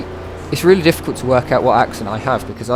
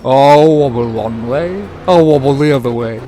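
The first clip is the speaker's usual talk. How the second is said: lend the truck a lazy, tipping voice; wobbly truck voice; 4 dB louder than usual. Pretend. Streamer voicing his truck on a long corner, swaying. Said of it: "the truck's like".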